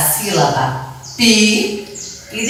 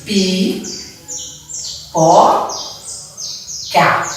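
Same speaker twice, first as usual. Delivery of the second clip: pi, po, ca with long pauses between; slow syllable-by-syllable dictation of pi, po, ca; separated syllables; much slower than usual. Shown as a demonstration. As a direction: give each of pi, po, ca its own beat. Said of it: "separei as sílabas de pi".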